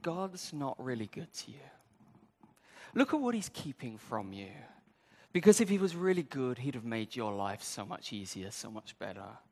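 lightly voiced envy whispering doubt; a hint of a sly tempter. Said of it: "And envy says".

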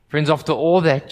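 ordinary talk

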